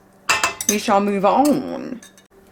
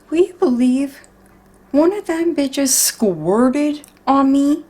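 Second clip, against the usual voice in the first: indignant disbelief; 5 semitones higher, 4 dB louder, slower than usual. Real, not acted. Egg squirted on her shirt.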